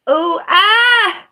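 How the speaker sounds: "oh" rising into a high, loud "ah!"; cry of pain. Real, not acted. Streamer hurting while moving, high pained cry. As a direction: yelp as the pain hits. In pain, cannot get comfortable.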